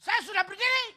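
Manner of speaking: loud, high exasperated comeback; loud mock indignant reply; irritated retort. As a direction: snap back that you are already standing. Annoyed retort to song leader.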